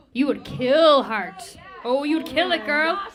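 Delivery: loud, emphatic hype; big, loud burst of real enthusiasm; excited urging. Real, not acted. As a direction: cheer the singer on at full volume. Judge excitedly urging singer toward rock.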